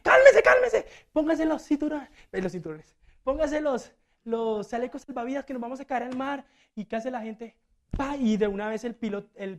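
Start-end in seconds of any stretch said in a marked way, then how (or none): 0.0-2.1 much higher, louder frantic shout; panicked yelling. Acted. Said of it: "Empieza a gritar". 3.2-6.4 higher-pitched frantic order; panicked attendant. Acted. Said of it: "Empieza a gritar".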